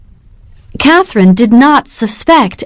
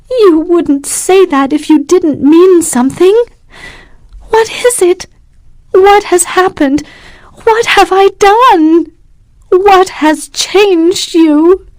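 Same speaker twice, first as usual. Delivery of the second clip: much higher, anxious pleading; desperate questions; 10 semitones higher than usual. A narrator's reading. Catherine desperately questioning Morris, frightened. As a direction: ask in mounting distress. Catherine voiced in read story.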